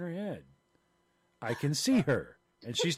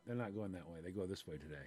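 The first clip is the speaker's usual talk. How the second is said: muttered correction; 7 semitones lower, 14 dB quieter than usual. Retold or imitated. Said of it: "some guy comes by and went".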